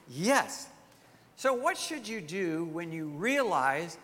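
ordinary talk

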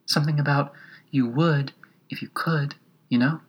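small voice right at the ear; little voice. A narrator's reading. Gnat prompting Alice to joke, little voice. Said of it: "said the little voice close to her ear".

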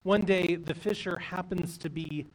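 plain reading voice

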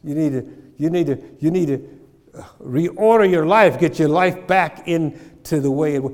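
full-force preaching. Real, not acted. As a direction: build and drive it home to the congregation. Preaching climax.

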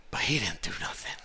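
hushed whispered aside. Real, not acted. To the congregation about the son, a whisper. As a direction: whisper it like a secret.

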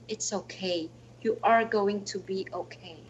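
soft, low, soothing whisper of comfort; warm inner voice. Retold or imitated. A gentle presence comforting her in pain, softly reassuring. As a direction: let it settle like a hand on the shoulder. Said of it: "very warm presence".

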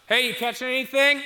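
high, loud call across the water. Acted. Jesus calling from the shore to the fishermen, loud high hail. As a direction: shout it out to the boat a hundred yards off.

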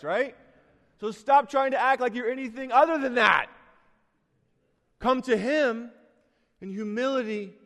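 loud, high preaching build; preaching at full force. Acted. Preacher urging sinners to come to Jesus, loud and high. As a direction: lift the room with a loud, high-pitched gospel call.

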